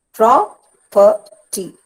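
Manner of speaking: "Property" slowly split as pro, per, ty, pro stressed; syllable by syllable. Shown as a demonstration. Teacher dividing a word for students, "Property" in slow stressed-first syllables. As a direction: punch pro, pause between syllables of "Property". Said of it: "Pro is stressed".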